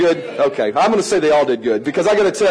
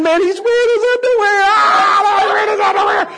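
high squealed mock outcry; kids' delighted shrieking; 14 semitones higher, 5 dB louder than usual. Kids in class spotting the teacher's underwear, imitated high shriek. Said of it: "and they're laughing".

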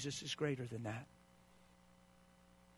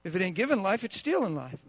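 loud, high-pitched pulpit fervor; fiery preaching; 4 semitones higher, 8 dB louder than usual. Real, not acted. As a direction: preach it hard to the congregation. Preaching climax.

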